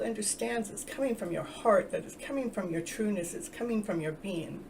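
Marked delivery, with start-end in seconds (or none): none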